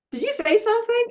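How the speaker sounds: high, playful mock-innocent question; husband pretending he didn't hear; teasing husband. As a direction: play dumb, teasing her about the nagging. Husband teasing her about nagging.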